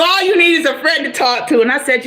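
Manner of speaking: usual speaking voice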